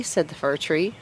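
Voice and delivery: ordinary delivery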